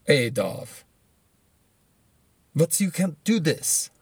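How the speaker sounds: badly faked German accent drifting into Russian; terrible German accent. Good people pleading with the dictator beside them, in a bad German accent. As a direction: attempt a German accent and botch it. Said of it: "That was a terrible accent".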